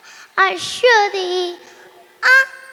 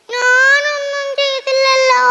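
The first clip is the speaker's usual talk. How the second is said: put-on higher, louder, whiny child's denial; protesting child; 4 semitones higher, 4 dB louder than usual. Daughter protests to scolding mother, whiny and higher. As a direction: plead innocence like a scolded kid.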